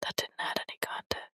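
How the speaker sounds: dry whispered aside. Real, not acted. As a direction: whisper it deadpan.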